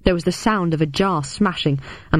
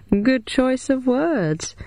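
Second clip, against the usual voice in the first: janitor voice; 7 semitones higher, much slower than usual. Acted. Janitor voiced, dry joke.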